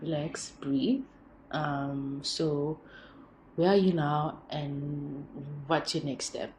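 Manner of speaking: put-on imagined boyfriend calming his panicked girlfriend, softly; calm soothing partner. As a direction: talk her down gently. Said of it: "And he's just like".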